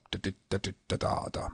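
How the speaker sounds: softly tapped-out "da" syllables in rhythm; "da, da, da" as quiet rhythmic scansion; set meter. Shown as a demonstration. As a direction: murmur the beat on da. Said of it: "it's pretty much set".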